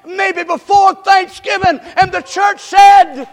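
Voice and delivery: hammered-home revival preaching; preaching at full force. Acted. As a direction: build the sermon to a shouted peak. Preacher builds to a shout.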